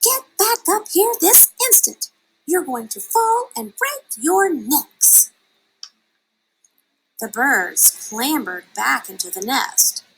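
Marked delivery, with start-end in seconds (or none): 0.0-5.3 alarmed mother's cry. Acted. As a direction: shriek it at the children in fright. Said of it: "She cried".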